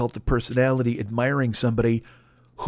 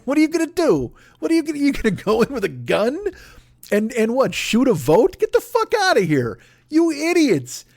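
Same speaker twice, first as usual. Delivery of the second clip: much higher, incredulous scolding of the gun crowd; mock outraged rant; 10 semitones higher than usual. Mocking protesters who bring guns, in a high, incredulous scolding voice. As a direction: sneer it up high like you can't believe them.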